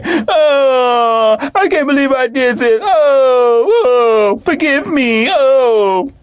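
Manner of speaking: very high, loud mock sobbing; hammed-up victim's bawling; fake crying. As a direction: wail long "oh"s to play the innocent. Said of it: "she's the innocent one by".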